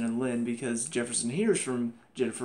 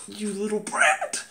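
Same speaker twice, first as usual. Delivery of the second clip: drawn-out, raised, exasperated scolding; exasperated outburst; 6 semitones higher, 3 dB louder, slower than usual. Real, not acted. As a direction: scold slowly with the pitch pushed up.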